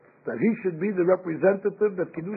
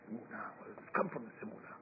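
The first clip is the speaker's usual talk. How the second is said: hushed, much softer marvelling; awed hush; 8 semitones lower, 19 dB quieter, faster than usual. Retold or imitated. Nations scratching their heads, murmuring in hushed awe. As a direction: breathe it out in wonder. Goyim marvel at his faith.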